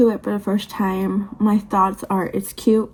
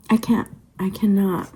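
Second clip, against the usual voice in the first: ordinary talk, nothing marked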